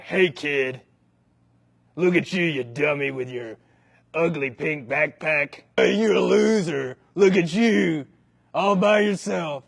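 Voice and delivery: mocking schoolyard bully voice; bully taunt. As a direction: sneer at the little kid. Bully fifth grader taunting.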